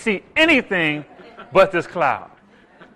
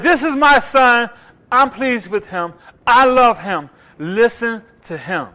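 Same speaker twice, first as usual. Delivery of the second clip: higher, louder, slow deliberate declaration; emphatic voice of God; 5 semitones higher, 7 dB louder, slower than usual. Retold or imitated. Preacher voicing God's words from the cloud. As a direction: proclaim each phrase with weight.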